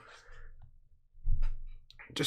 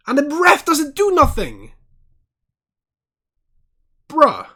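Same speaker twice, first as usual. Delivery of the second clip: loud, high outraged ranting at the screen; angry rant; 9 semitones higher, 6 dB louder than usual. Real, not acted. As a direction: shout it out at the dirty foul, furious. Furious rant about a late tackle.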